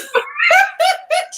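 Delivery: laughing high and breathless at her own joke; a squeal of helpless laughter. Real, not acted.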